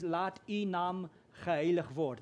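"Laat u naam geheilig word" said slowly in Afrikaans; Afrikaans line. Shown as a demonstration. Pastor quotes the Afrikaans line to the church, slow Afrikaans. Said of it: "In my language, it says".

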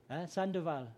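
usual speaking voice

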